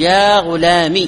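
يا غلامي slowly, ending in a short i with the ya dropped; with the kasra only. Shown as a demonstration. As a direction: say غلامي as ghulami with a clipped kasra. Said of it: "بكسرة فقط".

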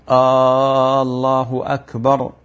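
"Aaallahu akbar" with the first hamza of الله stretched long, making it a question; muezzin's error: آه الله أكبر with a lengthened hamza; mispronounced call to prayer. Shown as a demonstration. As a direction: chant الله with a long drawn opening vowel. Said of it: "الأذان باطل".

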